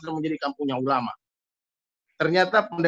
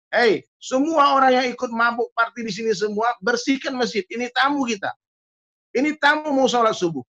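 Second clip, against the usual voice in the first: raised, higher-pitched retold shout; mock shout; 5 semitones higher than usual. Village head orders the revellers to clean the mosque, mock shouting. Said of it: "Kepala kampung langsung teriak".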